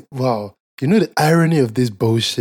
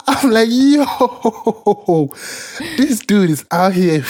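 laughing disbelief; 6 semitones higher, slower than usual. Real, not acted. Laughing at hypocrisy.

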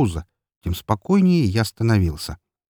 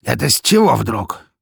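higher, louder spiteful boss; nasty, sneering question; angry sneer; 4 semitones higher, 5 dB louder than usual. A narrator's reading. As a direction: challenge it with malicious scorn. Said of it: "зло усмехнулся толстяк".

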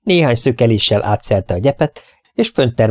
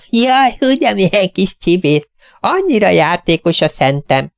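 doting little lady; 10 semitones higher than usual. Acted. Tiny owner laughing up at her cat, high and doting. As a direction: gush over the naughty pet. Mrs Beck voiced.

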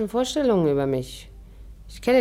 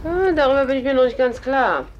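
coy tease; 8 semitones higher, 4 dB louder than usual. Acted. Coy teasing reply.

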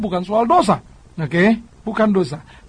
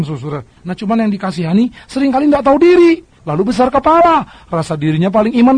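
ordinary talk, nothing marked

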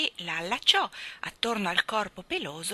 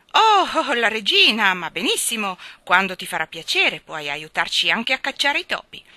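loud, hearty royal consent; jovial king; 3 semitones higher, 10 dB louder than usual. Acted. King welcoming the cat to visit the queen, jovial.

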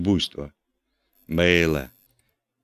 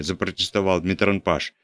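usual speaking voice

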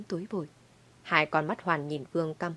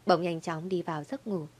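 ordinary delivery

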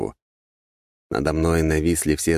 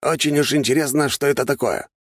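higher character voice, mild curiosity; lightly voiced curious Grigory; 9 semitones higher than usual. A narrator's reading. Grigory explains his interest to his master, lightly curious. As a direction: show mild interest in a raised voice.

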